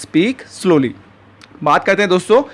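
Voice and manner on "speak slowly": very slow, each word spaced out for learners; slow teaching model. Shown as a demonstration. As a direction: say it slowly and clearly like a teacher modeling a phrase. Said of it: "और English में आप कहेंगे".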